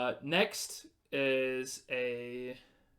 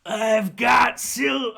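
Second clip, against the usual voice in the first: slow, high and much louder goblin shout; goblin yelling; 7 semitones higher, 11 dB louder, much slower than usual. Acted. Goblin captor yelling to the party from the darkness, menacing shout. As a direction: bellow it across the dark cave. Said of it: "and it yells out to you".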